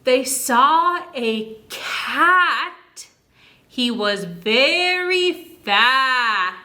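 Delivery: slow, sing-song reading of a rhyme; slow rhyming read. Shown as a demonstration. Teacher reading a rhyme to kids, slow and sing-song. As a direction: read the rhyme slowly to a child. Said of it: "So if I say".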